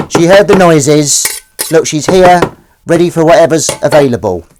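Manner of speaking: high, loud, put-on cat voice voicing her pet. Streamer voicing his cat Willow, high and loud. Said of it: "That's my Willow voice".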